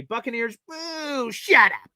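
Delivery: high, playful jeer; mock boo. Real, not acted. Fan jeering rival Buccaneers, playful high boo. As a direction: boo the other team for fun.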